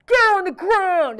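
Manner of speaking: loud, drawn-out cop shout; cops yelling. Retold or imitated. Cops shouting at A-Rod and Bonds, loud yell. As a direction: bark it like an arresting cop. Cops arresting the two players.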